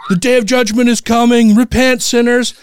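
high, mock doomsday preacher's call; retold yelling. Protesters warning atheists of judgment, mock yelling. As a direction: imitate a zealot proclaiming doom in a high voice. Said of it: "they all started yelling".

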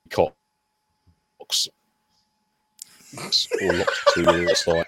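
choppy audio cutting words out; broken connection dropping syllables; glitching audio. Real, not acted. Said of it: "your audio's glitching".

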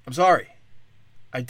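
usual speaking voice

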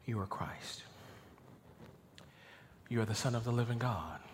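low, soft, settled declaration; quietly emphatic. Acted. Peter answers for the disciples, quietly emphatic. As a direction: state it softly, with no doubt. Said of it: "Peter was emphatic".

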